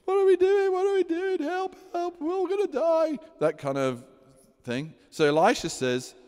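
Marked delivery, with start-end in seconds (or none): 0.0-3.2 high, hammed-up panicky king voice; panicking king. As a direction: play the frantic king begging the old prophet. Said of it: "that's my paraphrase".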